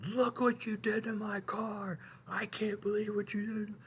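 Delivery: high, breathy, half-whispered imitation of the yelling; mock angry yell. Preacher imitates driver yelling about his car, mock angry. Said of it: "he starts yelling at me".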